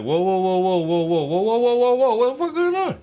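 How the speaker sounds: terrified pilot losing control; panicked pilot. Acted. Pilot panics as plane drops.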